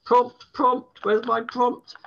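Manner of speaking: high, loud call for help; flustered calling out. Real, not acted. Poet who lost the line calling for a prompt, flustered. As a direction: call out urgently, pitched right up.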